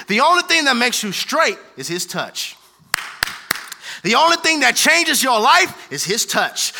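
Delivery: loud, high whooping sermon; preaching at full force. Acted. Preacher shouting the point to his church. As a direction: drive it home to the congregation.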